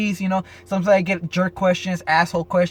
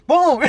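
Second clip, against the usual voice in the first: crash sound; 10 semitones higher, 6 dB louder than usual. Retold or imitated. Imitating a sudden crash.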